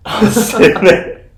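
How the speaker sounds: loud laughing retelling. Real, not acted.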